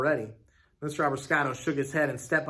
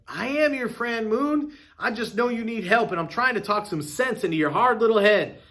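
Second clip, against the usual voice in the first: pleading shopkeeper; 7 semitones higher, 4 dB louder than usual. Acted.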